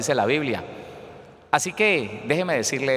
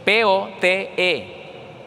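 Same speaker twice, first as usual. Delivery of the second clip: P O T E letter by letter, very slow; drawn-out, separate letters; spelling out; 5 semitones higher, much slower than usual. Shown as a demonstration. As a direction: spell it slowly and higher for the class. Spells out the Greek word.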